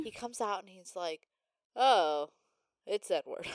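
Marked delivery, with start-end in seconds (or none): 1.8-3.5 sassy. Retold or imitated. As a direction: put on an unimpressed dad's sass. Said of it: "He has so much sass".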